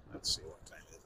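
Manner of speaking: quick unvoiced mutter under the breath; whispered to himself. Real, not acted. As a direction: whisper it to yourself. Muttering to himself, checking clocks.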